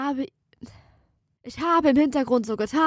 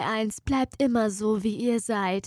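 ordinary talk, nothing marked

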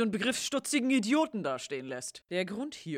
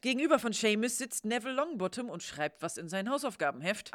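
normal talking voice

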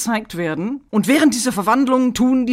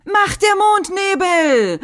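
high, slow transformation cry; bright magical girl; 11 semitones higher, slower than usual. Retold or imitated. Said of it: "dieser Verwandlungsspruch, den Bunny sagt".

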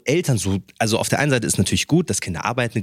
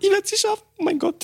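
mock panic. Acting out life crisis panic.

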